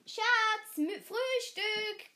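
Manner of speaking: high, loud, slow call; father calling up the stairs. A narrator's reading. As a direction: call her down to breakfast.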